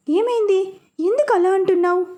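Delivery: alarmed, pitched high; worried mother pressing for an answer; mother anxiously asks her crying daughter. Acted. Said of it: "అని అడిగింది కంగారుగా".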